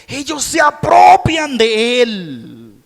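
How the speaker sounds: loud high fervent preaching; impassioned pulpit sermon; preaching at full force. Acted. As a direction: pour it out to the congregation, full volume.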